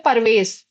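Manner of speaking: परवेश with a full पर, vowel between प and र; wrong pronunciation. Shown as a demonstration. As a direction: say परवेश as two open syllables, the wrong way. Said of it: "तो वो परवेश नहीं है". (परवेश, बीच में अ लगाकर गलत उच्चारण)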